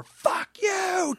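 high, held-back scream at no real volume; mock scream. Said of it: "she's screaming, like, from behind the door".

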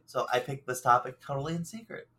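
normal talking voice

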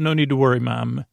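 usual speaking voice

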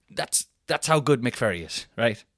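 ordinary talk

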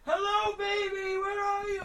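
very high, slow sing-song calling; searching the house, high calling voice; calling out for the baby. Retold or imitated. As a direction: call sweetly for a lost baby. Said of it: "we're going like".

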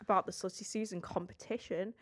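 ordinary talk

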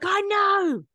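hammed-up squeaky excuse; whiny self-defence. Her younger self answering the ex, high whiny excuse. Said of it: "I was like".

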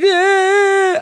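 put-on loud, high childish wail; long hammed-up bawl; mock crying. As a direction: imitate a child bursting into tears. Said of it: "Broke down crying right there".

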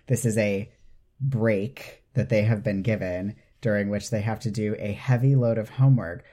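ordinary delivery

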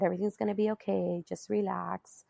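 soft unvoiced whisper, quick; reassuring nurse. Nurse calming the nervous mother, whispered reassurance. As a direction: hush her gently, breathy and fast. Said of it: "And he said".

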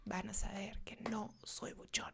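paranoid spy whisper. Acted. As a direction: whisper like a nervous infiltrator.

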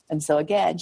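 normal talking voice

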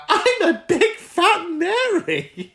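very high, slow, laughing camp shriek; camp squeal. Retold or imitated. Host quoting the caller's joyful camp cry, very high and laughing. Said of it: "being like".

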